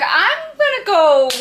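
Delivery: alarmed nurse. Retold or imitated.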